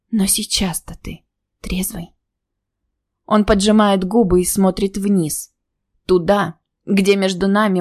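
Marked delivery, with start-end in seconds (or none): 0.1-2.1 hoarse and quiet. A narrator's reading. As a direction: rasp it out softly. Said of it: "хрипло говорю".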